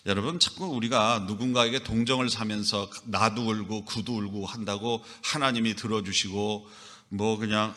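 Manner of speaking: ordinary talk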